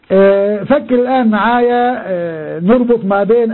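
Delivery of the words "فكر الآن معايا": ordinary delivery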